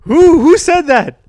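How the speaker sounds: high, loud, drawn-out cry; mock startled. Headset wearer calling to an unseen voice, mock startled. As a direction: call out as if spooked.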